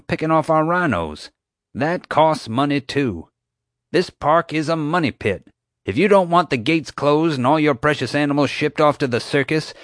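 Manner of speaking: park owner ranting about money; rising rant. Acted. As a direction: lecture them, getting worked up.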